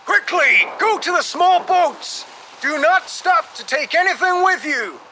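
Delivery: high-pitched urgent shouting; shouted orders. A narrator's reading. Captain ordering passengers to the small boats, shouting. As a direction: shout orders to a whole ship. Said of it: "the captain shouted to everyone".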